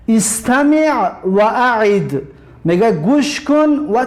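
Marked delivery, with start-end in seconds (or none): 0.1-2.2 استمع و اعد slow, with clear Arabic ain; Arabic instruction read out. Shown as a demonstration. Teacher reads the exercise heading to students in careful Arabic. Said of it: "التدریب الاول".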